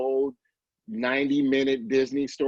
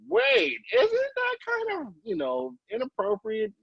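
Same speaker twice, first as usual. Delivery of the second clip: mock surprise; 9 semitones higher than usual. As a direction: pitch jumps up on "isn't that kind of", a half-joking double take. Mock reaction to old Seuss books.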